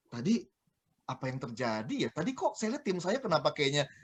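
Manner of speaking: low, hushed self-questioning; soft, low pondering; musing to oneself. Acted. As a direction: puzzle it over quietly, as if thinking aloud. Acting out a leader's silent self-reflection.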